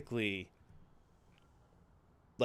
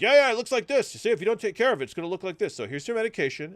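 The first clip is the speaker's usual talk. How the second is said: lightly voiced dismissive doctor, higher and louder at the start; mocking doctor; 8 semitones higher, 8 dB louder, faster than usual. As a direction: play the pushy doctor brushing him off. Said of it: "And he was like".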